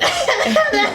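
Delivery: sudden high laugh. Real, not acted. Family answer amuses her.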